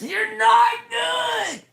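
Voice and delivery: slow, high mocking yell; mocking shout. Yelling the insult at the would-be rock star.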